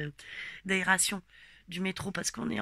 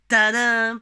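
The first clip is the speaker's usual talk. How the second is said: excited announcement; 3 semitones higher, 14 dB louder than usual. Real, not acted. Announcing a surprise in story.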